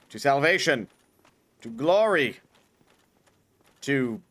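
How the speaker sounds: solemn, slow mock sermon; mock preaching. Player preaching mockingly to the game at large, solemn mock sermon. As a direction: preach it like a prophet, half joking.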